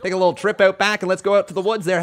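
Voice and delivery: hammed-up Canadian accent, lively and colloquial; full Canadian accent. Shown as a demonstration. Streamer showing viewers they can go full Canadian, thick Canadian accent. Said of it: "I can go full Canadian".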